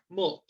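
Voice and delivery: "Mood" dropped softly in one beat; one syllable. Shown as a demonstration. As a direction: say "Mood" short, as a single syllable. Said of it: "Un solo golpe, una sola sílaba". ("Mood" de un solo golpe, suave)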